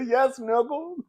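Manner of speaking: high, put-on sassy voice; hammed-up sassy retort. Vlogger voicing the angry girlfriend in a high mock retort. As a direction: snap back in a shrill-sounding mock drawl. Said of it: "She was like, basically".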